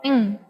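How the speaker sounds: "ing" sounded as one syllable, no initial consonant; final sound alone. Shown as a demonstration. Teacher isolating a syllable's final sound, "ing" on its own. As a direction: sound out "ing" by itself, ending on ng. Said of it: "and final sound is".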